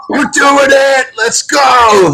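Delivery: high excited shout; hype shout. Pretend. S1 imagining hosts hyping the crowd, high shout. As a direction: whip up the crowd, yelling. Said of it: "this is [name]".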